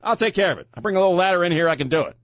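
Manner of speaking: high, sing-song fake brave protest; playing the wounded sidekick who insists he can cope alone; mock martyr. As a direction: insist you're fine, clearly not fine. Mock martyr refusing help from Chris.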